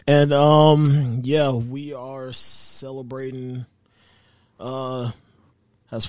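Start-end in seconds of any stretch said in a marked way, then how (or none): none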